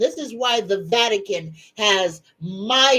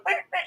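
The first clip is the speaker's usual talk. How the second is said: dog bark imitation; 12 semitones higher, 6 dB quieter than usual. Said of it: "you'll hear her bark going".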